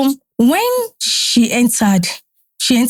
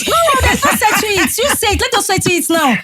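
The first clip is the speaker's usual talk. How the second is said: high excited protest; 9 semitones higher than usual. Retold or imitated. Rejecting husband's delay.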